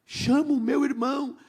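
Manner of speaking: imitated child's wail, drawn out; retold tearful plea. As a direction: plead slowly as a frightened little boy. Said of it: "começou a chorar".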